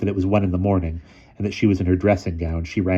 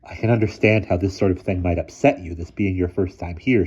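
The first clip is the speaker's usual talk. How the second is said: plain reading voice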